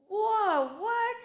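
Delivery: high, loud startled whoa; shocked. Retold or imitated. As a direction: blurt it out in disbelief. Retelling shock at the driver's text.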